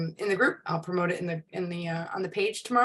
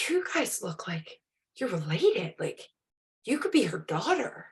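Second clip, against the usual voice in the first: hushed quote; 4 dB quieter than usual. Retold or imitated. Old woman quoted at therapy.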